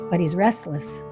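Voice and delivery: ordinary delivery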